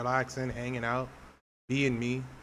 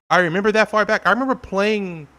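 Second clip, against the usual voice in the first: loud, higher, hammered home; emphatic shout; 6 semitones higher, 7 dB louder than usual. Real, not acted. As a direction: belt it out with conviction. Repeats phrase, then shouts it.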